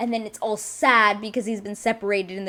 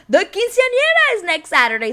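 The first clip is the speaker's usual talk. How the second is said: loud, high mock proclamation; hammed-up announcement, raised in pitch and volume; dramatic reveal; 12 semitones higher, 8 dB louder than usual. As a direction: belt out the big reason.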